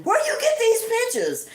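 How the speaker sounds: high, startled question; high spooked question; alarmed demand. Retold or imitated. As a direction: demand it in shock. Sister demands about photos.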